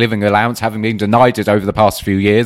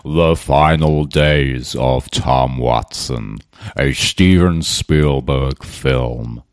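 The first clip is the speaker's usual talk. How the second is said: much lower, very slow, epic trailer narrator; movie trailer voice; 9 semitones lower, much slower than usual. Pretend. Pitching a tweet as a Hollywood film, mock trailer voice. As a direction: boom it out like a blockbuster announcer. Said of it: "It could be a bloody Hollywood film".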